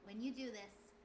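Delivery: ordinary talk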